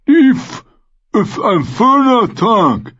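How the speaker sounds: sehr hoch, laut und langsam gedehnt; Vargo Hoth. Acted. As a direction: jedes Wort genüsslich und sabbernd ziehen. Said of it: "sagte Vargo Hoth". (der Söldnerführer freut sich über den Fang, hoch und gedehnt)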